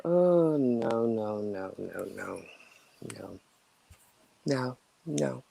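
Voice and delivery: falling, fading string of refusals; dismayed refusal. Real, not acted. Refusing a smelly investigation.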